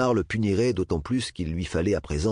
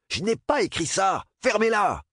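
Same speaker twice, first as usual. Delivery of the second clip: high, loud yelling denial; furious shout; 13 semitones higher, 3 dB louder than usual. Acted. Bernard yells at the mocking boys, high furious shout. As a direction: Bernard screaming his innocence at the room. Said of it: "de hurler sur les garçons".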